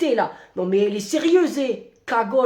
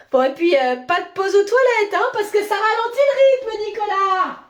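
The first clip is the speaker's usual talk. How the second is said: nosy boss voice; 8 semitones higher, 4 dB louder than usual. Acted. Boss voice again.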